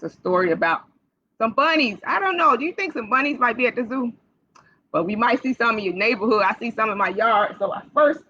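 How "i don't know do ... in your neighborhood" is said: ordinary talk, nothing marked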